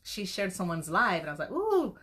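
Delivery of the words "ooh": high, loud delighted ooh; excited ooh. Retold or imitated. Her own delighted ooh at a seller's live. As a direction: squeal with delight at the find.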